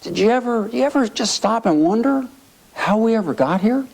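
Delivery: high, retold wide-eyed wondering; Sonny in awe. Congressman looking around the chamber, wondering in awe. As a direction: marvel aloud as if dumbfounded. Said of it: "he was just in awe".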